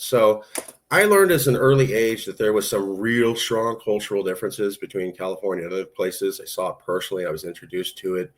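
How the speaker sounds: ordinary talk, nothing marked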